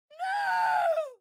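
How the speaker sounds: very high, piercing no; anguished shout. Acted. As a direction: scream the denial at the top of the voice. Denying the Master would kill.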